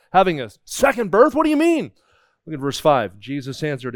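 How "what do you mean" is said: pitched much higher in disbelief; a baffled man who can't believe it; incredulous question. Retold or imitated. Nicodemus baffled by born again.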